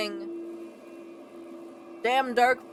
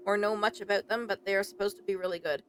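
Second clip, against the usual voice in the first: ordinary talk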